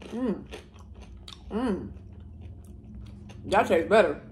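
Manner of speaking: talking while chewing; chewing food. Real, not acted. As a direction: mouth partly full. Seen 2: Bites fritter at -2.0, then chews with lips moving while saying "mm.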